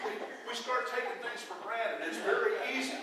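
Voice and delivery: amused, laughing voice; laughing through words. Real, not acted. Laughing after joke.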